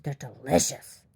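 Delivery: slow, drawn-out relish; relishing grandma. Retold or imitated. Grandma defending her Easter treat, slowly relishing. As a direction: savor it as Grandma would, stretching the word. Voices grandma savoring the eggs.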